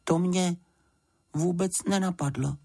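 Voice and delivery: high, small sheepish voice; crestfallen. A narrator's reading. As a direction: admit it haltingly, a touch deflated. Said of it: "řekl Paddington schlíple". (lehce schlíple, s pauzou)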